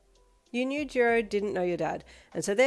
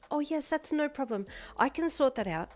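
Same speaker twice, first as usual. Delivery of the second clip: smiling; 8 semitones higher than usual. A narrator's reading. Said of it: "Momo said with a smile".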